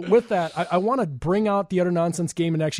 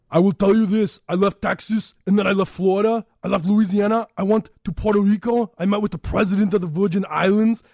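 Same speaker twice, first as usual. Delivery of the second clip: higher, forceful Austrian-accented impression; Arnold Schwarzenegger; 3 semitones higher, 4 dB louder than usual. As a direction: give a rousing speech in a put-on Austrian accent. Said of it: "Arnold Schwarzenegger".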